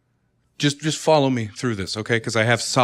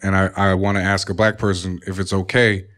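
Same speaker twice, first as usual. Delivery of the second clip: a lower, smooth crooner voice held through the call; deep smooth voice; 4 semitones lower than usual. Pretend. Husband on the phone to his wife, lightly voiced low crooner. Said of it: "I've been doing this voice for about a minute and a half".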